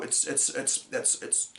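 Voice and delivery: soft stammering "it's, it's"; mock speechless stammer. Shown as a demonstration. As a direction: fumble for words, fading softly. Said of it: "that that that acting".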